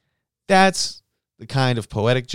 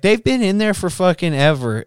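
put-on stubborn drunk woman refusing, pitched up; mock drunk lady; 8 semitones higher, 5 dB louder than usual. Drunk lady refusing the other bathroom, loud high whine.

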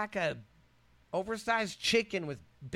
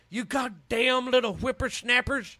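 put-on grumpy old man; 8 semitones higher, 6 dB louder than usual. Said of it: "Could you imagine?".

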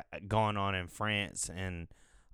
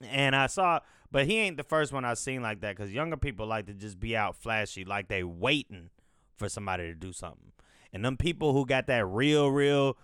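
normal talking voice

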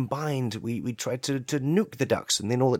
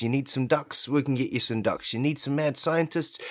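ordinary talk

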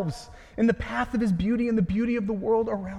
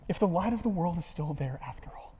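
whisper; 6 dB quieter than usual. Real, not acted. Preacher whispers the doubt.

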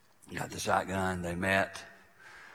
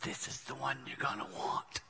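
much softer, nearly whispered; hushed; 4 semitones higher, 11 dB quieter than usual. Shown as a demonstration. Preacher advising congregation on life's end, hushed. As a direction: drop to a hush for the point. Pointing back to the whispered option.

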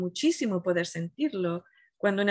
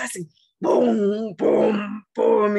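beats growing louder with each pum; imitated drums; 5 dB louder, much slower than usual. Procession drums resuming after the silence, louder and louder. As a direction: pound out the drumbeats, building.

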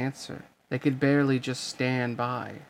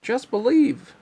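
much higher, louder reassuring voice; king's voice; 10 semitones higher, 6 dB louder than usual. Acted. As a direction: lift it high and encourage him. The king's voice continues.

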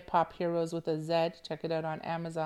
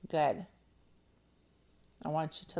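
ordinary talk

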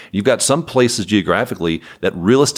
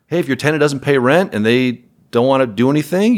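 ordinary talk

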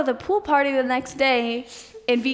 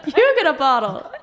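laughing plea; 4 semitones higher, 3 dB louder than usual. Retold or imitated. Throwing champagne bottles at people.

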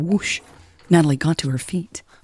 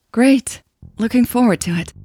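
forced cheer; 7 semitones higher than usual. A narrator's reading. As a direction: fake a smile through it. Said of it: "Natalie forced herself to smile".

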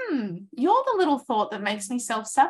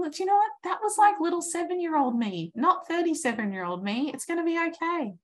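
reassuring younger self; 6 semitones higher than usual. Pretend. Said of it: "I'm gonna heal that by saying".

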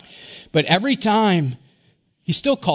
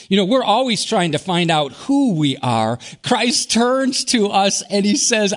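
ordinary delivery

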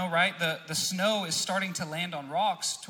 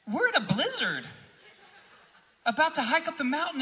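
higher-pitched incredulous scolding of a buddy; 9 semitones higher than usual. Retold or imitated. Friends confronting their buddy in sandals, incredulous. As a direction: act out friends' disbelief, pitch climbing.

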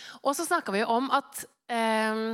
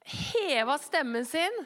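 ordinary talk, nothing marked

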